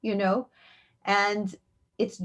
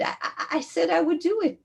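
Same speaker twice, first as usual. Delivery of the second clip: high, stammering retold protest; flustered defense; 9 semitones higher, faster than usual. Mother answers the woman about her daughter's wish, flustered. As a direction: trip over the start, pitched up and defensive.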